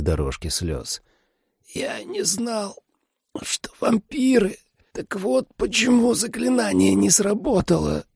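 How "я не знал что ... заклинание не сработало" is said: fading dying voice. A narrator's reading. Dying father realizing why the spell failed, weak and fading. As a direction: struggle out each phrase with failing strength. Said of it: "Его голос становился всё тише".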